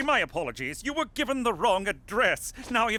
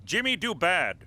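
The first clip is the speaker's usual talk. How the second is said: dumbed-down English; 3 semitones lower than usual. Acted. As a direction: say Jimmy do bad in simple broken words. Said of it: "Speak American".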